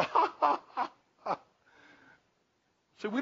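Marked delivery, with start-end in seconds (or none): 0.0-1.4 soft, high mock laugh as God; God's amused mocking chuckle. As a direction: scoff lightly at the puny idol. God laughing at idol makers.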